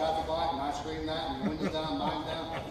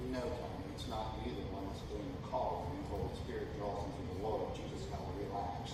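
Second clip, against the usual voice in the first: soft, low reassuring wife voice; gently calm him down; calm reassurance; 10 semitones lower, 10 dB quieter than usual. Retold or imitated. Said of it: "she has to remind me".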